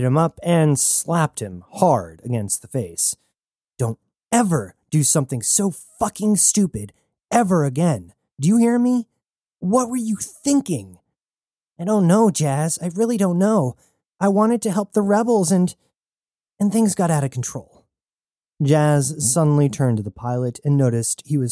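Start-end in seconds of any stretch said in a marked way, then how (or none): 3.8-11.0 sharp, rising scolding, pitch climbing high; angry scolding. A narrator's reading. Jazz scolding Tane after slapping him, furious and high. 11.8-17.8 higher, strained voice, on the defensive; shaken pleading. A narrator's reading. Tane answering Jazz after her slap, shaken and higher.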